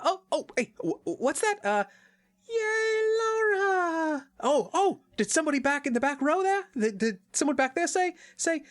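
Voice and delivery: very high, breathless, pleased mock Lara; milk imaginary applause as a high-voiced Lara; a high, hammed-up Lara hearing thanks from the back row. Lara voiced accepting fake thanks.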